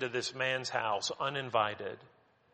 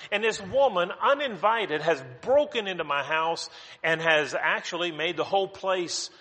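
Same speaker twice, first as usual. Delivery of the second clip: higher, louder, exasperated outburst; indignant host; 5 semitones higher, 4 dB louder than usual. Retold or imitated. Simon fuming at the woman crashing his dinner, indignant outburst. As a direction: huff and fume like an offended host whose party is ruined.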